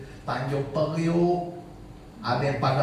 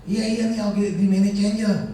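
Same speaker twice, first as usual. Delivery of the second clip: high-pitched, loud excuse; imitated stalling organiser; 5 semitones higher, 4 dB louder than usual. Organiser stalling over payment, imitated high-pitched excuse.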